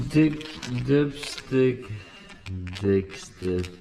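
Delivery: slow deliberate replay of "dick, dipstick"; slow mock repeat. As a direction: drag out "dick, dipstick" as a joke. Jokingly repeats his own slip.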